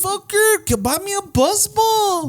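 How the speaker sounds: put-on high whiny girl voice; high teasing girl plea; hammed-up high girly whine; imitating girls. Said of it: "girls that are like".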